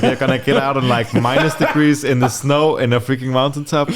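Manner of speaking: high, loud, incredulous pleading; protesting driver. Retold or imitated. Taxi driver pleads with tourist about the snow, loud high disbelief.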